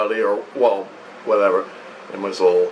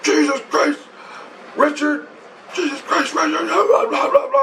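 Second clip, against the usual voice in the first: imitated scream; 13 semitones higher than usual. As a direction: cry it out high, imitating someone screaming. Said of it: "he screams".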